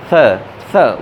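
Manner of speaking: "th" with the tongue tip against the upper teeth, air released; "th" said slowly, dental and airy; dental th sound. Shown as a demonstration. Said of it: "वो एक sound होती है dental sound".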